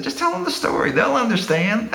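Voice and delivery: imitating a friend's high, eager insistence; mock insistence. Friend urging him to tell tenants the story, insistent.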